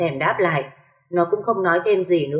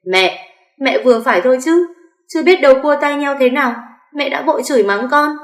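much higher, louder outraged cry; anguished shout; 8 semitones higher, 5 dB louder than usual. A narrator's reading. Narrator screams at her mother-in-law after being slapped. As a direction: yell back, stung by injustice. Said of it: "không kiềm chế nổi gào lên".

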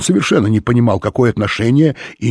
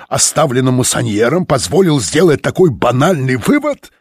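higher, louder scornful tirade; angry sarcasm; 6 semitones higher, 5 dB louder than usual. A narrator's reading. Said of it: "гневно сверкающим взглядом". (гневный сарказм)

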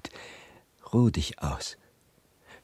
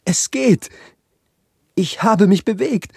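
high and loud, bursting with joy at moving; triumphant cry; 11 semitones higher, 7 dB louder than usual. Acted. Prince to swallow, overjoyed he could move, high loud cry. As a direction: cry out the breakthrough, much higher and louder. Statue finally manages to move.

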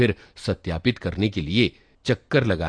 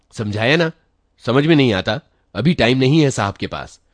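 loud, raised, impatient shout; shouting clerk; 5 semitones higher, 7 dB louder than usual. A narrator's reading. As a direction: bark him away from the door. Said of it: "वो चिल्लाता हुआ, चीख कर".

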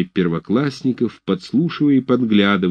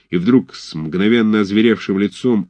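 ordinary delivery, nothing marked

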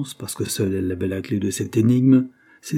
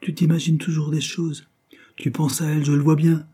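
impatient retort in a raised pitch; 7 semitones higher than usual. A narrator's reading. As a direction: argue back testily, pitched up. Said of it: "répliqua Henry avec impatience".

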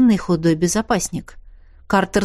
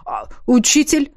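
flustered; 5 semitones higher than usual. Acted. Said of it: "растерянно отозвался безопасник".

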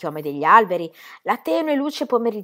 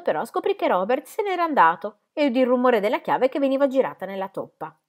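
plain reading voice

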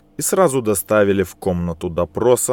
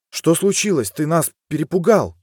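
frightened wife; 8 semitones higher than usual. Acted. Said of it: "подала голос женщина".

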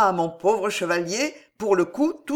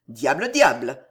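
grumbled low, a bit louder; grumbling; 5 semitones lower, 4 dB louder than usual. A narrator's reading. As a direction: grumble Diable, diable at the news. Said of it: "grommela le vieux Pardeillan". (grommelé plus grave, contrarié)

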